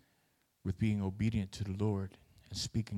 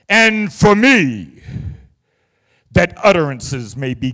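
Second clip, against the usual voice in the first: theatrical voice; 14 dB louder than usual. Shown as a demonstration. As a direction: declaim it like a stage actor. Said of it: "my theatrical voice".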